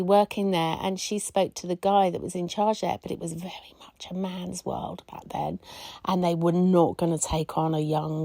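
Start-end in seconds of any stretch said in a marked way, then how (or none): 3.0-5.6 hushed, breathy whisper of a confidence; whispering an aside about the old days. Retold or imitated. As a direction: drop to a whisper as if sharing a secret. Retelling a sexist workplace.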